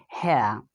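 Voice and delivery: here with the R dropped; British-style here without the R; non-rhotic here. Shown as a demonstration. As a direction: end here on a vowel, no R. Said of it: "will pronounce this word as hair".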